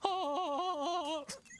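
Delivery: high hammed-up cackle; a high mock British laugh. The British impression's trademark high laugh. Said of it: "That laugh".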